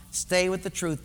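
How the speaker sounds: ordinary delivery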